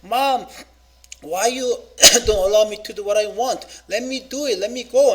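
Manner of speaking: put-on pleading child. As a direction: beg like a kid refused by his parents. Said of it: "And they say".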